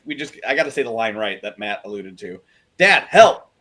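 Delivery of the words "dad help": loud, raised mock cry for help; calling for help. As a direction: call out to dad as if in trouble. Quoting a character's cry for help.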